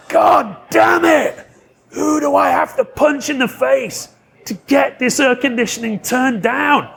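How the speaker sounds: high, drawn-out angry shout; furious outburst. Retold or imitated. Star fed up with the air conditioning, furious shout. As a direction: imitate a red-faced star exploding with rage. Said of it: "his face is bright red".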